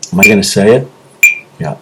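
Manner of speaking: ordinary delivery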